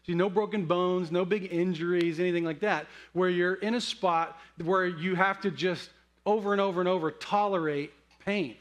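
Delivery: ordinary talk, nothing marked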